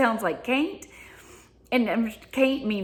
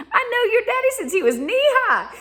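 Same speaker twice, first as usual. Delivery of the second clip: put-on old Southern lady; 6 dB louder than usual. Lady in the grocery store greets the speaker, high and loud. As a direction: play the grocery-store lady who knew your daddy. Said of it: "I was like".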